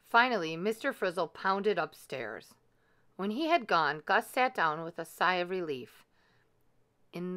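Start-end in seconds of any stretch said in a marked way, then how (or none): none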